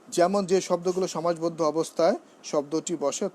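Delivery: usual speaking voice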